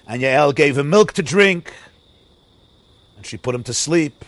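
ordinary delivery

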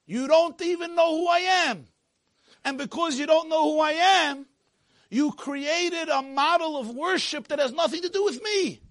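high, loud, impassioned proclamation; full-force preaching. Acted. God rebuking Yaakov for false worship, shouted preaching. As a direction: thunder it out to the whole congregation.

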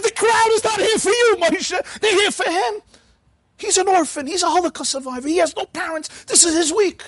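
high, insistent scolding; rebuking gabbai. Retold or imitated. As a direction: lecture the old man that this is the orphan's week.

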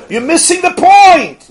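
high, loud, drawn-out protest; loud, stretched-out plea to be understood; exasperated outburst. Acted. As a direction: cry it out in mock exasperation. Acted outburst at oblivious husband.

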